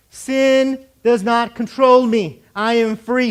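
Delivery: slow, forceful proclamation; loud declaration. Shown as a demonstration. Preacher leading the congregation's declaration, loud and slow. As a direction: declare it loud, drawing out each word.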